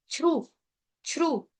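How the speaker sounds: श्रु said alone as a clear syllable; teacher repeats श्रु on its own; syllable drill. Shown as a demonstration. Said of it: "उच्चारण".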